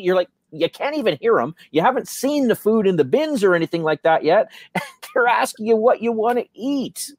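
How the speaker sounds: normal talking voice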